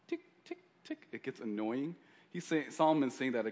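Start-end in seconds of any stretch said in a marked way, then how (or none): 0.1-0.6 very high, soft tick imitating drips; dripping sound. Shown as a demonstration. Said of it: "It's like". 0.6-1.1 fading unvoiced tick of a drip; whispered dripping sound. Shown as a demonstration. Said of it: "It's like".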